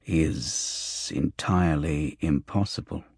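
low, soft, drawn-out refusal; slow, deliberate. A narrator's reading. Petal objecting to Sally taking the girl, slowly. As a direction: refuse slowly, spacing out each word.

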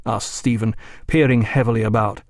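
plain reading voice

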